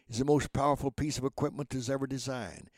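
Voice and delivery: ordinary talk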